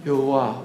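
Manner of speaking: Yuah breathed out softly, like a breath; breathy Yuah. Shown as a demonstration. Pastor showing the church Yahweh as a breath, breathy. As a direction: let Yuah out as a breath, low and soft. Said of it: "Take a deep breath".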